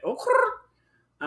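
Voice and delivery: loud, high rolling crrr of delight; high flirtatious growl; high growly purr. Real, not acted. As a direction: purr like something hot just walked by. Swooning purr again.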